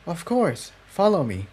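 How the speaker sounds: gentle, willing invitation; reassuring. A narrator's reading. Cerulean leads Jigsaw to his friend, softly reassuring. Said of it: "Her voice was very reassuring".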